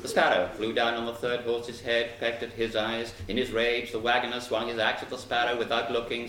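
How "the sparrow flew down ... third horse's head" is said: ordinary delivery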